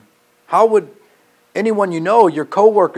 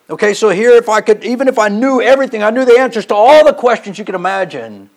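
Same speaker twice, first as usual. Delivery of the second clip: loud, raised pulpit preaching; preacher building up to the congregation, loud and high; preaching at full force; 4 semitones higher, 6 dB louder than usual. Acted. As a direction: drive the verses home to the congregation.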